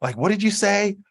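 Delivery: high, squeaky mock voice of a puzzled listener; confused listener. People who couldn't understand his island accent, squeaky mock confusion. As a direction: pitch it way up like someone who can't follow his accent. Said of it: "I got tired of people saying".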